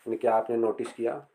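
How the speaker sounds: ordinary talk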